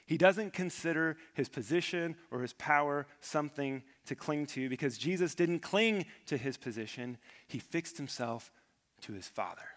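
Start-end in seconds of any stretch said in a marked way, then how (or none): none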